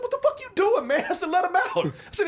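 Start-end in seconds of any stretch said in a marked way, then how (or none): 0.0-1.0 high-pitched retold outcry; alarmed protest. 1.3-1.9 high, louder retold plea; urgent demand. Retold demand to free Kevin.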